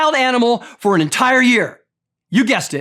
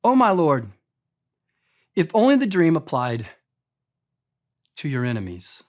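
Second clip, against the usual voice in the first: respectful; 6 semitones lower than usual. Retold or imitated. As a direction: break hard news to the king gently. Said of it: "Here's what Daniel said".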